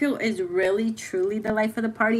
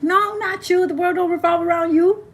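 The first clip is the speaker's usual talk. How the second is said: teasing; 8 semitones higher, 4 dB louder than usual. Retold or imitated. Teasing a self-centered friend.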